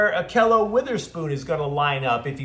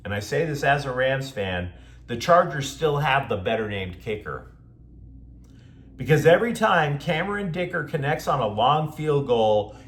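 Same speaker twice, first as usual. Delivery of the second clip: ordinary talk, nothing marked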